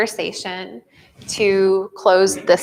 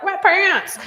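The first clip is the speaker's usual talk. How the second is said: mock announce; 11 semitones higher than usual. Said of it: "and I was like".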